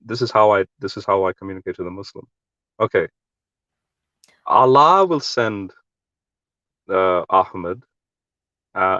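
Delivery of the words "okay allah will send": normal talking voice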